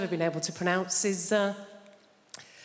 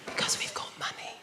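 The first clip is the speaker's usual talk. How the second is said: hushed, breathless whisper; 6 dB quieter than usual. Pretend. Comic whispering like a middle-class low talker. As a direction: whisper it like a posh secret. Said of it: "low talkers".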